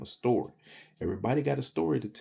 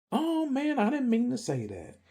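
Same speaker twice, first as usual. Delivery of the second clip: much higher, sing-song fake apology; mocking Christians. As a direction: parody a churchgoer pretending to be sorry. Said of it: "they used to say".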